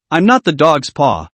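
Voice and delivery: plain reading voice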